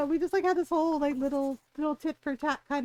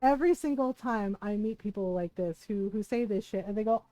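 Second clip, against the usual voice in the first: normal talking voice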